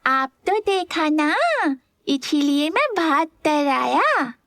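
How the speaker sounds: put-on high, slow child's voice with a tearful whine; a small kid half-crying his excuse; whiny little boy. A narrator's reading. Little boy tearfully explains to his uncle, high and slow. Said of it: "विराज बोला".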